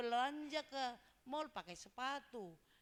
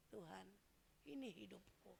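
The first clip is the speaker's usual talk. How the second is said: much softer, lower, intimate prayer; David quietly praying to God, hushed and lowered; 3 semitones lower, 11 dB quieter than usual. Acted. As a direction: pray it to God from the heart. Voicing David's heartfelt prayer.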